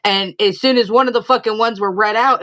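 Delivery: normal talking voice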